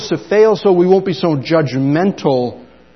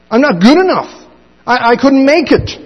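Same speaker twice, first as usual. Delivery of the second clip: high pleading complaint; despairing doubter; 8 semitones higher, 4 dB louder than usual. Acted. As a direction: whine the self-doubt up in pitch. Voicing a fearful doubter.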